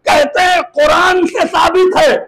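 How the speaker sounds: high, sustained, fervent pulpit cry; climactic preaching. Acted. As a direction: build the argument to a ringing climax.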